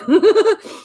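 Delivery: loud, high giggle; real burst of laughter; laughing. Real, not acted. Laughing at Shannon's rude comment.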